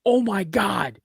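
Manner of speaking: high, falling gasp of recognition; starstruck shock. Retold or imitated. Retelling bumping into Keanu Reeves, starstruck. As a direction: act out a stunned realization.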